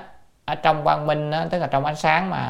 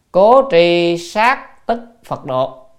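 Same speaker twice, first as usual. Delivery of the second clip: slow, loud, higher-pitched recitation; classical recitation; 4 semitones higher, 9 dB louder, much slower than usual. Real, not acted. Teacher reads the text's conclusion, slow loud recitation. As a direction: project the line word by word.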